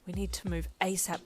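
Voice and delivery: whispering. Acted. Whispered to herself.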